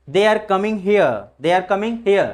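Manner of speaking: "here" as hi-a without r; silent r. Shown as a demonstration. Teacher modelling example sentences, "here" with silent r. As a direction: let "here" fall on an open schwa. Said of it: "आर के जगह पे replace अ".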